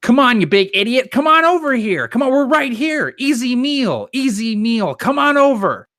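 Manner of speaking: high, loud taunting shout at the ogre; mocking the ogre from across the bridge, high taunting yell; taunting call. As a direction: jeer across the gorge like bait. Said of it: "he'll call out to the ogre".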